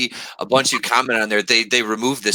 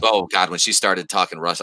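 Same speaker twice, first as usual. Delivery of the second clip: ordinary delivery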